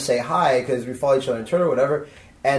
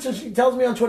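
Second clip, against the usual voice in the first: usual speaking voice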